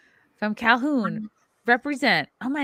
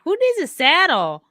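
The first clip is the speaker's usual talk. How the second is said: carefree bravado; 8 semitones higher, 6 dB louder than usual. Retold or imitated. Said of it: "and I was like".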